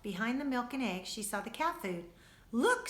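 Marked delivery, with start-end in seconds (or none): 2.5-2.9 high, loud, eager look; eager call. A narrator's reading. Lucy spotting the cat food, high eager call. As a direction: point it out high and bright. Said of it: "said Lucy".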